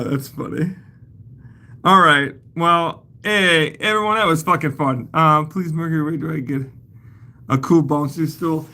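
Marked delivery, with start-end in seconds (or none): none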